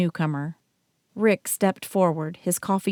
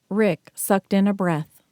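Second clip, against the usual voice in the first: ordinary delivery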